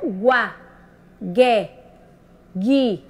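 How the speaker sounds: "Guá, gué, gui" each on its own, with a pause between; teacher's separated syllables "Guá, gué, gui"; digraph sound demo. Shown as a demonstration. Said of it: "Já é outro sonzinho".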